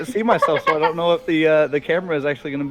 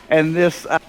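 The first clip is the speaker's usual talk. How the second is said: ordinary delivery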